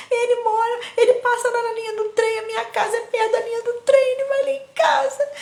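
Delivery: high, loud half-laughing sob; imitating herself crying to the teacher; crying child. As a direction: wail it like a scared little girl. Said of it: "Eu chorava".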